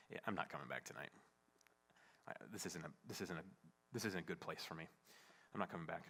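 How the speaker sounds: low, hushed thinking to oneself; hurt inner resolve. Retold or imitated. Husband walking out after a fight, quiet hurt resolve. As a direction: keep it low and subdued, as a private thought.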